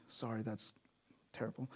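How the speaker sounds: a quick embarrassed whisper to the room; whispered aside. Real, not acted. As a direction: whisper "Sorry, that's terrible" under the breath. Apologetic aside, voice drops away.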